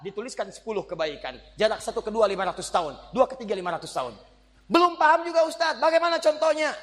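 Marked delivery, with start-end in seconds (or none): 4.7-6.7 much higher, louder voice of a confused listener; puzzled congregant. Pretend. Congregant asking the ustaz for an example, high pleading voice. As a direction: play a student pleading for an example. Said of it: "Saya contohin".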